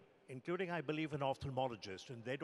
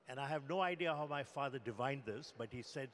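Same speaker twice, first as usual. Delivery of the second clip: normal talking voice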